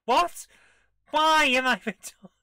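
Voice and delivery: loud, high, laughing disbelief; loud laughing what; shocked outburst. Real, not acted. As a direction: yelp in disbelief, then laugh. Game character asks odd things.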